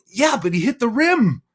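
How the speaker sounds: high, loud, joyful team cheer; excited cheering. Retold or imitated. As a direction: cheer it like the team just won. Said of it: "And they're all go".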